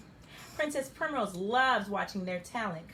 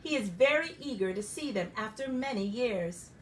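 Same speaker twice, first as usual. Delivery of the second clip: ordinary delivery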